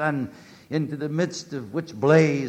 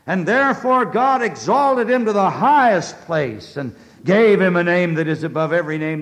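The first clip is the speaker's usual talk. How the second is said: high, loud and quickening; triumphant pulpit reading; proclaiming scripture; 5 semitones higher, 11 dB louder than usual. Acted. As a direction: lift the verse to its peak.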